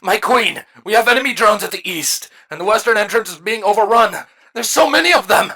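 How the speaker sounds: high, urgent soldier's report; high-pitched alarm building to a cry; panicked. A narrator's reading. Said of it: "quickly followed by another".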